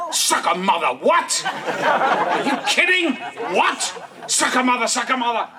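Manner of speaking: high, loud, laughing indignation; mock outraged cursing. Opponent protesting the line call, mock outrage. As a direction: sputter it like a furious opponent. Angry opponent's outburst retold.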